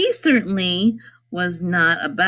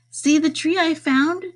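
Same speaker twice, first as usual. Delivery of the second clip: mildly excited cry; 8 semitones higher than usual. A narrator's reading. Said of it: "he cried".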